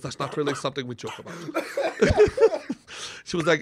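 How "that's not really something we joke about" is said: quick, low Austrian deadpan; brisk, dry Austrian rebuke; unbroken matter-of-fact Austrian. Retold or imitated. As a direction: imitate a straight-faced Austrian, softly. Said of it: "without breaking stride, she goes".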